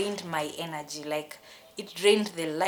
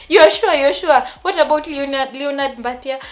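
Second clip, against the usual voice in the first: high, loud desperate questioning; acted-out distraught plea; retold tearful pleading; 8 semitones higher, 15 dB louder than usual. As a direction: plead in alarm, replaying the call. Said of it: "I started crying".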